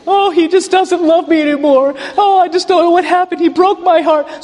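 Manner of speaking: high, whiny lovesick lament, hammed up; a hammed-up high weepy voice; mock heartbroken woman. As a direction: parody a girl sobbing over a breakup. Mocking women playing sad songs.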